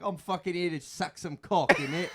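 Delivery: a hammed-up cheeky British lad; British accent.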